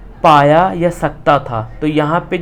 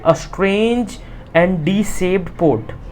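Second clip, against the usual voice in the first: very slow word-by-word reading; slow reading aloud; much slower than usual. Shown as a demonstration. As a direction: read it out carefully for the class. Teacher rereads textbook line. (धीरे-धीरे पढ़ना)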